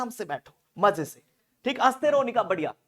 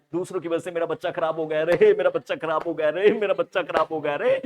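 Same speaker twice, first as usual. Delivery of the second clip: mock wailing shout; 2 semitones higher, 10 dB louder than usual. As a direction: yell it like a family blaming the neighbours. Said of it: "ऐसे चिल्ला रहा है". (ज़ोर से चिल्लाता, नाटकीय विलाप)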